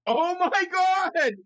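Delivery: real thrilled outburst over a gift; excited shout. Real, not acted. Unwrapping a handmade bobblehead gift.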